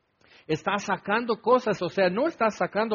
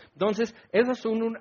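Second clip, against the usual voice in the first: usual speaking voice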